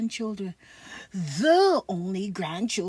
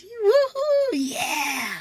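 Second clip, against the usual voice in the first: excited cheer; 9 semitones higher, 7 dB louder, much slower than usual. Real, not acted. Kids cheering at baseball practice.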